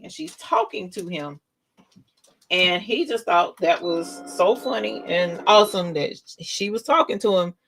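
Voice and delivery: ordinary talk, nothing marked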